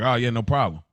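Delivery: normal talking voice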